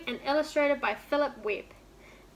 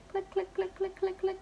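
high, soft clicking sounds; needles clicking; 7 semitones higher, 6 dB quieter than usual. A narrator's reading. Narrator imitating the knitting needles, high and soft. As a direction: tick the clicks out lightly like needles. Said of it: "went the knitting needles".

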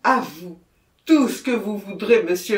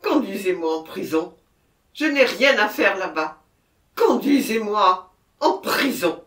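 loud, raised voice of a desperate confession; frantic outburst; 5 semitones higher, 10 dB louder than usual. A narrator's reading. Murderer refusing to go to the scene, frantic outburst. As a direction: protest at full voice, beside yourself.

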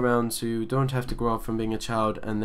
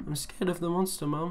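put-on high, soft frightened child; a small child confiding fear to mum; scared child; 5 semitones higher, 5 dB quieter than usual. Scared boy tells his mum about the monster, small frightened voice.

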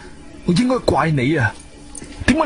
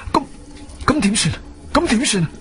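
panicked; 6 semitones higher than usual. Acted. Hears of head injury, panics.

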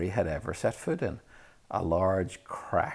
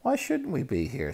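higher, heated retort; hotly; 9 semitones higher, 3 dB louder than usual. A narrator's reading. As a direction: snap back defensively. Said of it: "said Ron hotly".

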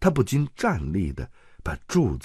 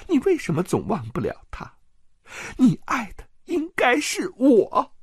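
high-pitched jealous woman's voice, reproachful; a jealous wife throwing his old kiss back at him; jealous aunt; 13 semitones higher than usual. Acted. Jealous aunt pleading with Petyr in a high voice.

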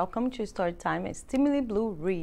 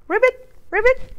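frog croak; 12 semitones higher, 6 dB louder than usual. Acted. Animal sound in picture book.

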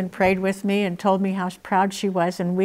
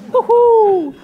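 high, loud joyful woo-hoo; gleeful whoop; 11 dB louder than usual. Retold or imitated. Cheering as she carries the sign through the airport, high loud whoop. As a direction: whoop it out like a triumphant fan.

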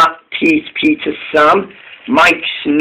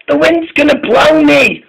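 frightened engine; 11 semitones higher than usual. A narrator's reading.

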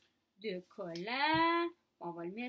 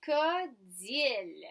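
crocodile slow in three separate beats: cro, co, dile; syllable by syllable; 4 dB louder, slower than usual. Shown as a demonstration. Teacher reading back the spelt word, crocodile slowly by syllable. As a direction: stretch crocodile, one syllable at a time.

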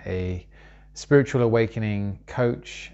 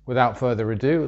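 usual speaking voice

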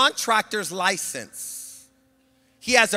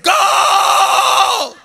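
loud high shout; retold shout at the demon; shouted command; 13 semitones higher, 10 dB louder than usual. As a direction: bark it at the demon. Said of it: "I said".

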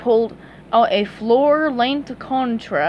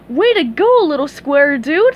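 higher, louder Texan cheer; hearty congratulations; a cowgirl's proud whoop; cheering squirrel; 5 semitones higher, 7 dB louder than usual. A narrator's reading. Sandy voiced.

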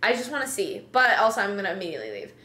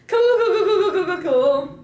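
laughing; 7 semitones higher, 8 dB louder than usual. Real, not acted. Celebrating success.

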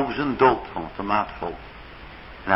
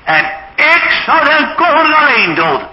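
much higher and louder, koning held long and falling; shouted vow; 14 semitones higher, 6 dB louder than usual. Acted. Ahithophel vowing to Absalom to kill the king, shouting. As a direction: cry it out like a blood oath. Counsellor vows to kill David.

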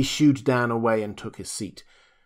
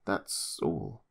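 fading repeat; 5 semitones lower, 7 dB quieter than usual. Acted. Julius trailing off to the doctor, deflated. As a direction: lower and softer, deflated echo.

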